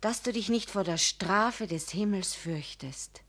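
low, soft, ominous; quiet, solemn warning; grave warning. Acted. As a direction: drop to a hushed threat.

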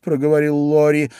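ordinary delivery, nothing marked

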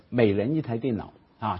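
normal talking voice

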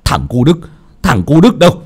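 enraged grandfather storming off; angry shout. Acted. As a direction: bark for the boy, building into high yelling. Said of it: "Ông nội quát".

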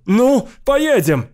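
very high, slow pleading howl; long wailing cry, pitched far up; terrible scream. A narrator's reading. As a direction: drag the plea out in panic. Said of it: "страшно кричал он".

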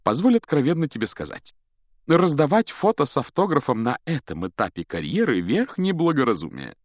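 high-pitched, pompous counsel; patronizing advice. A narrator's reading. Lockhart advises Harry on his career, condescending. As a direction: advise him as a self-satisfied celebrity.

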